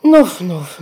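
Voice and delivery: lautes schnaufendes Nuff; Igelschnaufen. Acted. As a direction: schnauf wie ein Igel. Igelin schnauft. (lautes Igelschnaufen)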